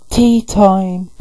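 high bright sing-song call; chirpy Chimecho. Retold or imitated. Chimecho calling everyone to tea, high and cheery. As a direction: announce it like a cheerful little bell. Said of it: "It's like".

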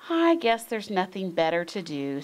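usual speaking voice

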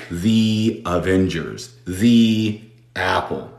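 the said as thee before Avengers and apple, slowly; the before vowel. Shown as a demonstration. Teacher shows the before a vowel, slowly, as thee. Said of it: "tenemos una vocal después".

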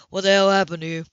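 usual speaking voice

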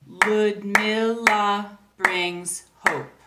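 each syllable spaced out very slowly; clapped syllables. Shown as a demonstration. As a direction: clap out every syllable slowly. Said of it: "if you clap those syllables".

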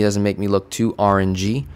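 usual speaking voice

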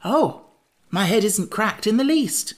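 high, relieved character voice; cheerful. A narrator's reading. Jack relieved his head is whole, cheerful and high. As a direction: pipe up cheerfully, pitched well up. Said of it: "declared Jack more cheerfully".

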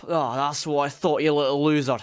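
ordinary delivery

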